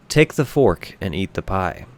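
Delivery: ordinary delivery, nothing marked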